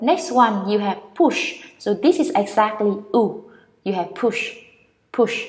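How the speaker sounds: ordinary delivery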